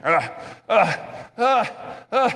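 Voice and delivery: imitated man's grunts, rising; light imitation of a man grunting outside; mock grunting. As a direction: grunt like a stranger talking to himself. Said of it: "like a man's voice, like, grunting".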